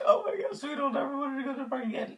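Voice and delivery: very high, loud squeaky retold wail; hammed-up high-pitched tearful whine; mock crying. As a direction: whine it out fast, imitating two crying kids. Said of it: "Me and my cousin were both crying".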